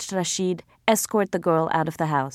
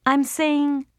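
restrained anger; 8 semitones higher than usual. A narrator's reading. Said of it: "trying to maintain control".

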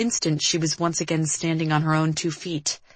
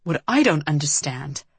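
usual speaking voice

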